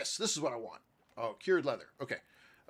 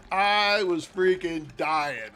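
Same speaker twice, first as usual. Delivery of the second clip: helpless loud laughter breaking up the words; real laughing fit; 7 semitones higher, 9 dB louder, much slower than usual. Real, not acted. Storyteller cracking up at own colonoscopy joke, laughing hard. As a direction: laugh so hard you can barely get it out.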